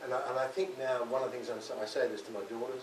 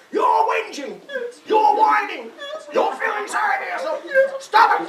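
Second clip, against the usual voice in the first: shrieked scolding, much higher and louder; screaming harpy; 18 dB louder than usual. Retold or imitated. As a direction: scream it down the phone like a furious harpy. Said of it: "She screamed down the phone like a terrible harpy".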